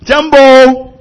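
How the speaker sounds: loud, high "Jumbo"; shouted greeting. Shown as a demonstration. Preacher calling Jumbo to the congregation, loud. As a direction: call it out to the hall. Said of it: "我讲说".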